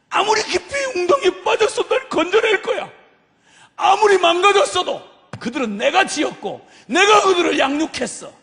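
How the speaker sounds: high, loud fervent declaration; full-force impassioned preaching. Acted. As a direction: cry it out with all your might.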